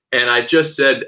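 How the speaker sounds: usual speaking voice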